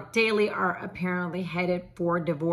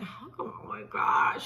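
put-on embarrassed kid whisper; 2 semitones higher, 4 dB quieter, slower than usual. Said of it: "I was like".

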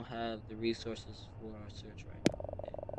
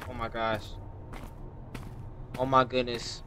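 ordinary talk

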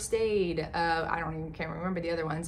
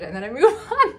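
laughing; 4 semitones higher, 10 dB louder, faster than usual. Real, not acted. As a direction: laugh through the words. Shrugs off hard questions.

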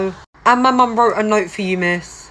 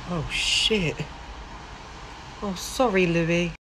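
soft, embarrassed backpedal; sheepish apology; 10 dB quieter, slower than usual. Acted. Teacher apologizing to grieving student, soft and sheepish. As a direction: drop the voice, caught out. Teacher learns about hospital.

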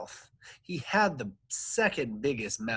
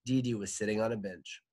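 usual speaking voice

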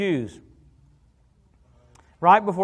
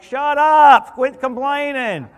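loud, exasperated shout of shut up, then slower quit complaining; mock angry God; 7 semitones higher, 9 dB louder, much slower than usual. God losing patience with complainers, mock shout. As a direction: bark it like a fed-up father, then drag it out. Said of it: "He's like".

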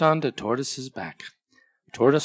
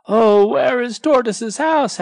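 much higher, louder anxious cry; worried friends; 11 semitones higher, 8 dB louder than usual. Acted. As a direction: call out over the storm.

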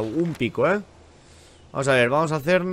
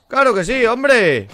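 high, loud sarcastic cheer; sarcastic shout; 8 semitones higher, 6 dB louder than usual. Real, not acted. Streamer greeting yet another horde, loud sarcastic shout. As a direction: yell a bitter oh-great at the horde.

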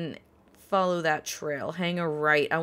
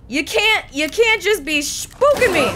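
startled yelling; 12 semitones higher, 10 dB louder than usual. Real, not acted. Scared by wolf attack.

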